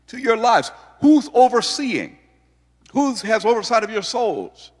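high, loud, rising preacher's cry; full-force preaching. Acted. Pastor calling out to the congregation, full-force preaching.

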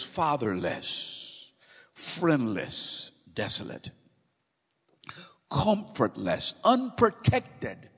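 a solemn roll call; slow dramatic list. Acted. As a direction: let every word land.

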